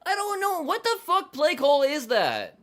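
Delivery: yelling at the TV over a dumb play call; angry yelling. Real, not acted. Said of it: "make me lose my fucking voice".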